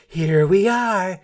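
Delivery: city mouse calling out, thrilled; excited cry. A narrator's reading. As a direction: announce arrival with glee. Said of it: "City Mouse cried".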